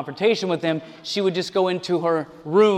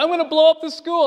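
quick, higher hammed-up threat; mock angry threat; 8 semitones higher, 4 dB louder, faster than usual. Preacher imitates the upset student's quick mock threat. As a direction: toss off a bratty threat. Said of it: "He's like".

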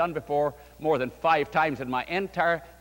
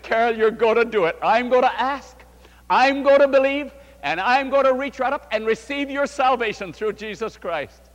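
higher, louder, insistent preacher's promise; confident urging; 5 semitones higher, 5 dB louder than usual. Retold or imitated. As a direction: push the faith into her, building high. Pastor retells urging Carol.

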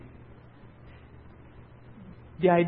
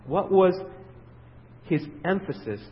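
usual speaking voice

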